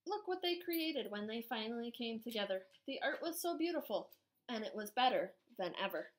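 put-on lively storybook narration for kids; reading to children. A narrator's reading. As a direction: read it aloud to a class, animated. Reads picture book to children.

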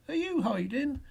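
high, rising question; inquisitive call. A narrator's reading. Stranger peeks at Boo under the bed, inquisitive. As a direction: ask lightly, pitched right up.

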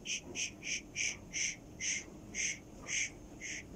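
soft repeated shh; gentle shushing. Real, not acted. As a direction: hush the bird with long shh sounds. Shushing the bird.

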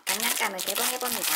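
normal talking voice

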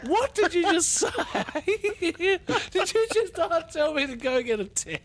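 can't believe the insult, squeak it out; incredulous disbelief. Retold or imitated. Retelling the tan insult to mates, squeaky disbelief. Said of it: "And I'm like".

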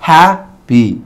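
Happy broken in two, strong HA then lighter 'py'; first-syllable stress. Shown as a demonstration. Teacher showing which syllable is strong, Happy stressed at the start. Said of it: "la que tiene mayor fuerza".

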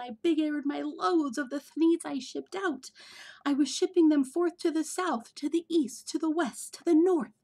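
越说越兴奋，声音一路拔高变响; building excitement. A narrator's reading. Boastful build to climax.

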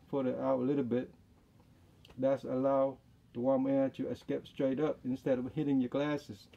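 muffled behind surgical mask; speaking through mask; through a face mask. Real, not acted. Seen 2: Surgical mask worn over nose and mouth throughout; references unmasked.

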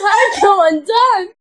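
high, loud, drawn-out mock cry; over-the-top imitation of ecstasy; very enthusiastic. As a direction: wail it with hammed-up passion. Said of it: "Very enthusiastically".

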